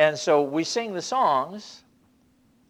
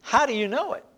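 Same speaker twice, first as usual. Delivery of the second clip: usual speaking voice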